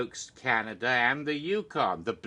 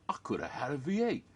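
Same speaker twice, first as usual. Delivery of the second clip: breathy secret whisper, a bit quick; 2 semitones higher, faster than usual. Pretend. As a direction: whisper it like a sly ad aside. Parodying a V8 ad slogan.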